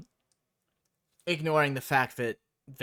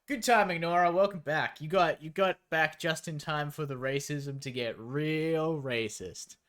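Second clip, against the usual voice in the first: ordinary talk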